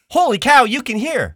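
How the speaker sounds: high, loud holy cow; excited outburst. Retold or imitated. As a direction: burst out in amazement. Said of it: "the timeline of the moment literally goes".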